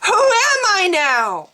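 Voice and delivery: high anguished cry, loud; a woman screaming in anguish; hoarse scream. Acted. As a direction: scream it out in despair. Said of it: "she screamed at them hoarsely".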